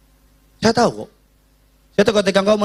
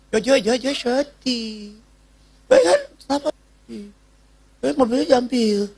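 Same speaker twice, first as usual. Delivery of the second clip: put-on crying child; 6 semitones higher, slower than usual. Voices crying little son.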